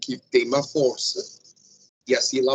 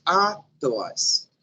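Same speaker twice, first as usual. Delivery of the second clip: "atlas" with a held first a, rest weaker; first syllable stressed; 2 semitones higher than usual. Shown as a demonstration. Teacher shows the tonic syllable, stressing the first a of "atlas". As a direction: lean on the opening a of "atlas". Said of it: "Primeira sílaba tônica e o resto átona".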